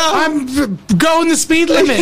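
high, slow, nervous pleading; a panicked driver insisting he's innocent; mock panicked driver. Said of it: "and I'm like".

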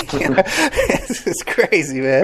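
high, slow words through a laugh. Real, not acted. Guest reacting to how crazy this is, laughing. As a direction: let real laughter break into the words.

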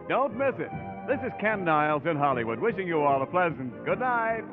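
usual speaking voice

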